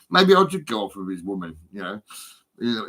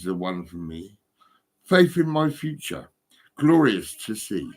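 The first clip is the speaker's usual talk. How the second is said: slow, lingering poem reading; poem recital; slower than usual. Acted. Scene: reading his summer poem to the caller, slow recital. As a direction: savour each line like a love poem.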